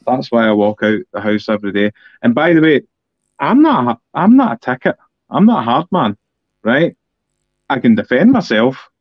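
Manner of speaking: ordinary delivery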